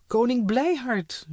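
high, loud relieved cry; relieved shout. A narrator's reading. Girls spotting the king above, high relieved shout. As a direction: greet him with loud relief. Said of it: "riepen de meisjes opgelucht".

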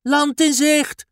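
high, slow, loud excited cry; a girl spotting land and calling it out. Acted. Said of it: "roept Ellie uit". (hoog, langzaam en luid uitroepen)

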